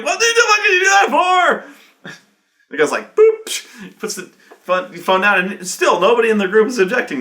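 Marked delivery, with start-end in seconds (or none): 0.0-2.1 high, loud mock outrage; furious shout. Sergeant shouting at the runners by phone, mock rage. 3.1-4.0 high, loud mock phone boop; a cheeky hang-up noise; hang-up beep. Troll hanging up on the sergeant, comic boop.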